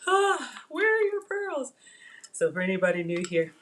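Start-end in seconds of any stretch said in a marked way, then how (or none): 0.1-1.7 high, drawn-out gasping question; hammed-up dismay, pitched way up; mock shock. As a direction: imitate everybody aghast. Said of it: "everybody was like".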